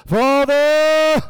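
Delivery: booming, high, very loud prayer call; God voice. Retold or imitated. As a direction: thunder it like an over-the-top preacher. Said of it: "he has his God voice on".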